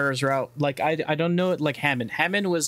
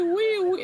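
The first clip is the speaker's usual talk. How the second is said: very high squealing wee; high squeal scattering crowd; playful wee. Pretend. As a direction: squeal like a kid on a ride. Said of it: "you can just go wee".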